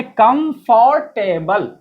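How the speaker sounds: कंफर्टेबल in slow separate parts, "kam-for-TAY-bal"; wrong joined-up pronunciation. Retold or imitated. Teacher mimicking learners joining words, slow "kam-for-tay-bal". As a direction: say कंफर्टेबल as com, for, table glued together. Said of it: "पढ़ दिया मिला करके".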